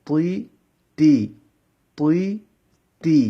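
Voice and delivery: "প" and "তুই" heavy, "তা" and "তি" light; letter drill. Shown as a demonstration. Teacher drilling heavy and light letters for students. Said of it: "এভাবে".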